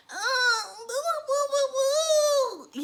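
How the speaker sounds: high-pitched fake wailing; exaggerated crybaby wail; mock whining. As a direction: whimper "woo woo" in mockery. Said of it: "one whiny bitch in the group".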